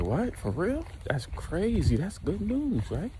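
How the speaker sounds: high, lilting mock amazement; retold surprised reaction. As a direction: swing the pitch up in disbelief. Red reacts to going-home news.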